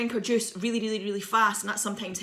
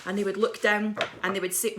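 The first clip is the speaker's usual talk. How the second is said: ordinary talk, nothing marked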